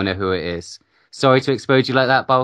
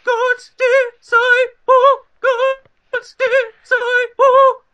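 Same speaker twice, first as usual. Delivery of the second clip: drawn-out, very high echoing announcer call; grand name announcement; 5 dB louder, much slower than usual. Acted. Host's friend announces his own name in a slow, very high announcer voice. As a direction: boom the name out like a ring announcer.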